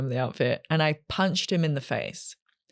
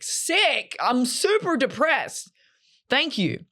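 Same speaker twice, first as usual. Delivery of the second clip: high, mock-cheerful sarcasm; sarcastic reply; 7 semitones higher, much slower than usual. Answering a nightclub insult with high mock-cheerful sarcasm. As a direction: chirp it back, dripping sarcasm.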